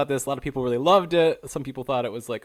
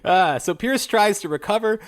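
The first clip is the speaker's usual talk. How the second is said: usual speaking voice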